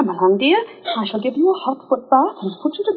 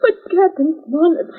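normal talking voice

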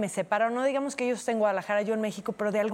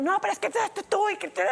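much higher whiny protest; mocking the ex; 10 semitones higher than usual. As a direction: mimic him sputtering excuses. (imitando burlona al ex, mucho más agudo y quejumbroso)

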